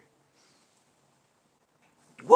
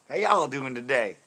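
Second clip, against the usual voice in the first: loud, raised Texas greeting; Texas accent; 4 semitones higher, 10 dB louder than usual. Shown as a demonstration. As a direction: greet folks in a big Texan voice. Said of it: "Doing in my Texas".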